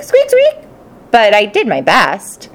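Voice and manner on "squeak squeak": high squeaky squeak squeak; hamster squeaks. Acted. Hamster's song heard only as squeaks, high and squeaky. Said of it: "all she could hear was squeak".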